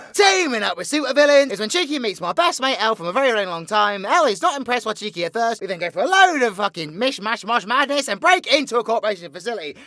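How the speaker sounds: high, loud, rapid-fire villain storytelling; excitable theatrical villain rattling off a plot; villain's breakneck recap. Acted. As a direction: race through your exploits with showman's gusto. V recaps the series in character.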